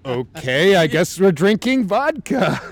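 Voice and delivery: high, drawn-out mock-enthusiastic yell; mock loud acceptance. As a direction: give in with a loud, slow, rising-pitch holler. Acted reply to the Russian's dare.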